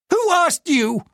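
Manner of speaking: high shrill shriek. Acted. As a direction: shriek at the chauffeur. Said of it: "Diane shrieked".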